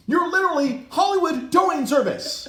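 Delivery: high, loud, indignant tirade; furious rant. Retold or imitated. Retelling his argument with the towing lady, furious rant. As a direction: let outrage climb into a shrieky shout.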